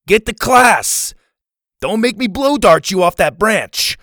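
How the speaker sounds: high, loud shouted order; calling up. A narrator's reading. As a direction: holler a threat up into a tree. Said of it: "Kirby called up to a kid".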